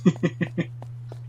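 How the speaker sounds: quick high breathy laugh; high-pitched laugh. Real, not acted. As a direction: laugh at the teasing. Laughing at friend's remark.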